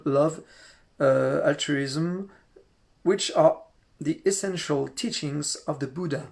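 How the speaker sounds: slow, careful English read with a French accent. Real, not acted. Reading a lama's quote to the group, slow French-accented English. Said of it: "Excusez mon accent".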